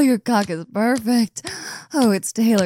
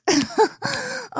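mocking laugh. Mocking her partner's kink.

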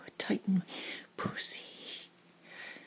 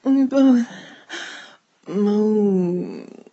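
loud, drawn-out moan on the words; 2 semitones higher, 12 dB louder, slower than usual. Acted. Narrator voicing shared pleasure, loud moaning delivery. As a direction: let the words swell into a moan.

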